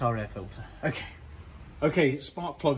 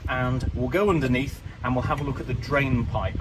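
ordinary delivery

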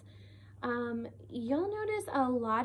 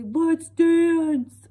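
loud, big silly elephant voice; 7 semitones higher, 15 dB louder than usual. Shown as a demonstration. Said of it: "I gave Elephant kind of a".

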